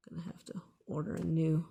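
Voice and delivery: ordinary delivery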